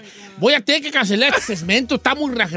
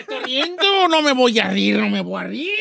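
words straining through escaping laughs; stifling laughter. Real, not acted. Host promising not to laugh at a death, losing the fight with laughter. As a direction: fight the giggles, drawing the words out. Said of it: "por qué está pujando".

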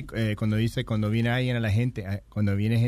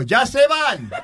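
sudden shouted order, pitched up; kid yelling; 10 semitones higher, 6 dB louder, much slower than usual. Retold or imitated. Friend retells the boy shouting at guests, loud and high. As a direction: bark it out like a blunt kid.

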